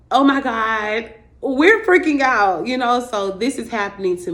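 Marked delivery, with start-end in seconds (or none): 0.1-2.7 mock panic. Body goes into full-blown panic.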